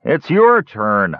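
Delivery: high, slow, sleepy guard. A narrator's reading. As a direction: drag it out half asleep. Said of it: "another said in a sleepy voice".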